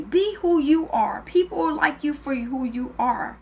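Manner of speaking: slow emphatic. Real, not acted. Closing motivational message.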